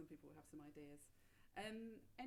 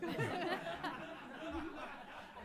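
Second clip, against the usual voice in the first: real laugh; 10 semitones higher, 12 dB louder than usual. Real, not acted. Host laughs at Phil's refusal.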